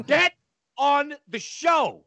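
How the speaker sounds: high, slow, drawn-out mock yell; wife yelling. Wife punching him, yelling high and slow. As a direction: bark it at him like a pushy wife. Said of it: "so she yells too".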